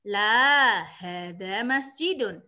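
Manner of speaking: hadza maktabun, each vowel drawn out; slow vowelled reading. Shown as a demonstration. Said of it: "sambil diharokati". (hadza maktabun dibaca pelan dengan harakat)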